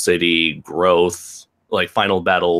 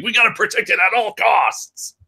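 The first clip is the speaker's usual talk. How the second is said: high, mock-serious protective voice; lightly voiced overprotective guy; mock paranoid voice; 12 semitones higher than usual. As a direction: ham up a worried owner. Mocking a paranoid owner.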